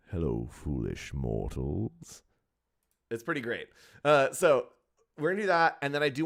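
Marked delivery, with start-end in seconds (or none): 0.1-2.2 deep, slow ghostly host voice; low sinister welcome, drawn out; dark lord. Pretend. Spooky ghost host line.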